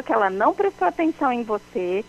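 ordinary talk

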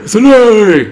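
hammed-up high, loud holler; mock anguished shout. Co-host joining in on the film quote, hammed-up shout. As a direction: echo the movie outburst at full volume.

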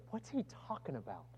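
a hushed, breathy whisper between friends. Retold or imitated. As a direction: lean in and whisper the puzzled question. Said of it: "They're sitting there whispering to each other".

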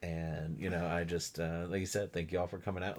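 a hint of a Southern nice guy; easygoing Kenny impression. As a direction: lean back and sound homely. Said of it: "And Kenny's like".

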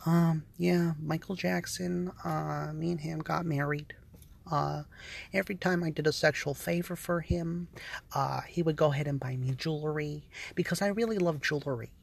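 hushed, low mumbling impression; mocking the fairy's voice. Mocking a man from the documentary, low and hushed. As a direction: mutter it low like he doesn't want to be heard. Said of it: "He was talking like this".

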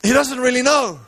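high-pitched dramatic sermon telling; impassioned preaching. Acted. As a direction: preach the story at full pitch. Preaches the story at full force.

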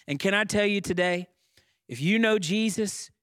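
preacher lifting the church to its feet; fervent preaching. Acted. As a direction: proclaim it to the congregation. Preacher builds to full force.

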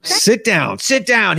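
mock-yell a command at a player; imitated yelling. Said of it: "He's, like, yelling at his".

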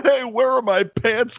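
a hint of a confused party guy; clueless drunk guy. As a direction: play him lost and pantsless, lightly voiced. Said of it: "He basically turns into the".

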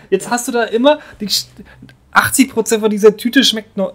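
loud, high rant; Wutrede. Real, not acted. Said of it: "Da muss ich jetzt auch mal eine Wutrede halten". (aufgebrachte Wutrede)

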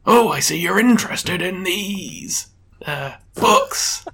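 put-on slow, high elderly voice; old man. Old judge shows off his case books, old man voice. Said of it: "I'll just do an old man voice".

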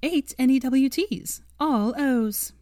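proud, bright and lifted. Acted. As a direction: beam as you announce it. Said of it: "She brightened".

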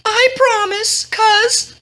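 very high, sweetly obedient voice; girlish young woman. A narrator's reading. Girl promises her cousin, high and girlish. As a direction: put on a high girl's voice.

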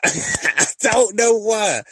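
slow, loud laughing disbelief. Real, not acted. Host laughing to listeners about men leaving the room, loud laughter. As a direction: laugh through it, drawn out.

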